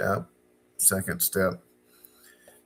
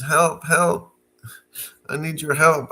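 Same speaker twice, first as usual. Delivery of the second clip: higher, louder cry for help; calling for help; 6 semitones higher, 6 dB louder than usual. Retold or imitated. Trapped in the basement, calling up loud to his friend.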